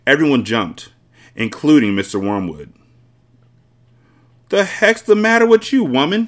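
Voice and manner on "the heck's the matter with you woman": high-pitched, exasperated shout; scolding shout, pitched up; angry shout. A narrator's reading. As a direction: shout it as the outraged husband. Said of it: "he shouted".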